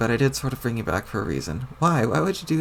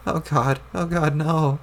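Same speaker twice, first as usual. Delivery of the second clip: normal talking voice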